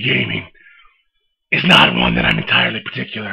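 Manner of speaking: deep gravelly Batman growl; low, growling caped crusader. Acted. Holds the Batman voice.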